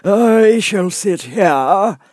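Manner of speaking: high, slow, lofty footman voice; frog footman. Acted. Frog footman dismissing Alice at the door, lofty. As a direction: talk down to her from on high. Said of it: "repeating his remark with variations".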